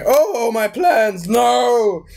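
high, drawn-out lament; hammed-up high-pitched despair; mock villain's wail. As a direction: wail it slowly like a foiled villain. Acts out villain losing his plans.

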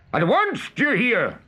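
high-pitched commanding cry; Hook imitation. A narrator's reading. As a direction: demand obedience as the pirate captain. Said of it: "cried Peter".

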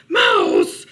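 high, very loud shout; alarmed cry. A narrator's reading. Bear frightened by rustling, shouting for Mouse. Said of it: "he cried".

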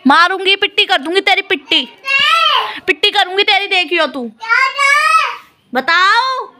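high, loud playful telling-off; hammed-up angry mum threatening a smack; mock scolding. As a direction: pretend to be cross with the baby, all in fun. Mother playfully scolds her baby.

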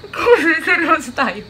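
loud, high words bursting with laughter; laughing. Real, not acted. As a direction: try to speak through the giggles. Cracking up while trying to be serious.

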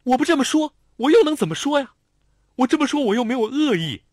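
loud defense. A narrator's reading. Lanlan loudly defends herself to Xiao Tong. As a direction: argue back as if in a fight. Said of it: "像吵架一样的大声辩解".

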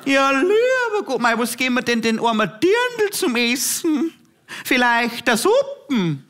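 put-on high, slow, wailing old granny; hammed-up granny lamenting the end of the world; grandma shocked by a vegan guest, wailing to the priest; horrified grandma. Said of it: "grandma voice again".

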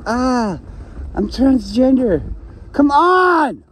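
hammed-up outrage at the mirror; mock exclaim. Seeing himself in a corset in the mirror, mock outraged cry. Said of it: "I see myself in the mirror and I'm like".

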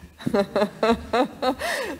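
a real burst of laughter at the joke; hearty laugh. Real, not acted. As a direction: laugh out loud, amused. Laughs at the speaker's quip.

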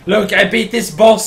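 ordinary delivery

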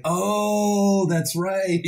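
high, loud sudden realization. Retold or imitated. Recalling his talk with Steve Blum, a high loud realization. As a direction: act out the penny dropping. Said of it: "And I'm like".

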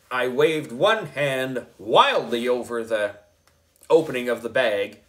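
plain reading voice

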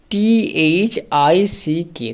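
letters spelled out very slowly; slow spelling. Shown as a demonstration. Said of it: "থিক মানে হলো পাতলা।". (ধীরে ধীরে বানান করে)

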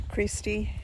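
ordinary talk, nothing marked

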